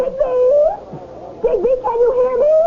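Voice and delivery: high, fast anxious calling; wife calling to her swallowed husband. Acted. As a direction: shout down to him inside the whale. Calling into the whale.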